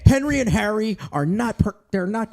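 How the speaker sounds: high, loud comic complaint; mock exasperation. Husband telling his wife his testicles won't cooperate, mock exasperated. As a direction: raise the pitch in hammed-up grievance.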